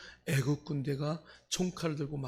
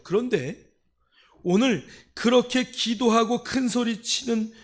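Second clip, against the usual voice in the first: loud, high-pitched sermon delivery; impassioned preaching; 7 semitones higher, 10 dB louder than usual. Real, not acted. Preacher telling the congregation crying out is prayer, forceful preaching.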